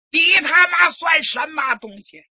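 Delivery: furious yell, high and loud; angry shout. Acted. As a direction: blast the insult at him. Official scolding the kneeling recruit. (怒气冲冲地大骂)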